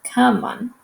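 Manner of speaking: ordinary talk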